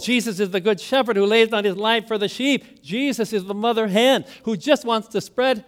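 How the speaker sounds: normal talking voice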